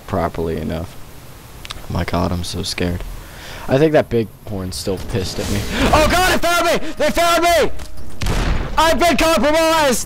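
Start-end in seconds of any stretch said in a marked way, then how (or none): 5.9-10.1 very high, frantic alarm; panicked shriek. Real, not acted. Enemies find the player.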